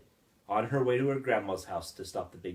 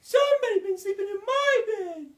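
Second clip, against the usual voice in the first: loud, very high falsetto mother bear; shrill it out as an indignant mother bear; high-pitched, loud bear complaint; Mama Bear; 8 dB louder than usual. A narrator's reading. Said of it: "And Mama Bear says".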